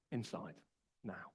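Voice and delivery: low-key, quiet two-word command; imitating a grandfather's calm order. Grandfather calmly orders the boy inside, quietly. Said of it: "very calmly just says".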